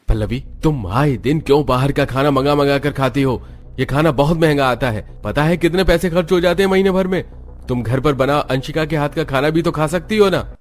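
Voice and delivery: rising into an angry yell about money; angry scolding. Acted. Husband scolding wife for ordering food, angry and loud.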